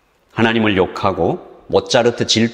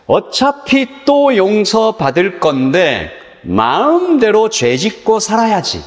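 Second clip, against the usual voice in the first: mocking a careless sinner; 9 semitones higher, slower than usual. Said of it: "바보 멍청이".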